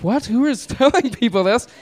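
high, incredulous retold question; mock disbelief, pitch jumping up. Acted disbelief at strangers' questions.

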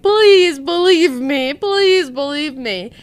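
slow, high begging plea; pleading. Retold or imitated. Pleading with friends to believe her, slow and high. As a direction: beg them to believe you, near tears.

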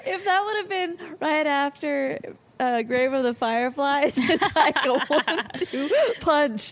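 laughing through the words; giggly, breathless from laughter; after laughing hard. Real, not acted. Laughing after grim film talk.

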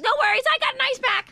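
put-on very high, squeaky kid voice; unbothered little boy. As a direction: imitate a small son calling out cheerily. Mother voices upbeat little son.

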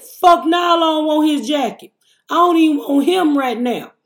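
high loud scandalized retort; indignant refusal. Retold or imitated. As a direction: refuse the jacket in disgust. Retorts to paramedic's offer.

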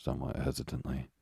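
ordinary delivery

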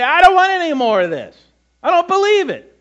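high, loud defiant outburst; defiant rejection. Acted.

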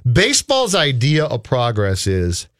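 ordinary delivery

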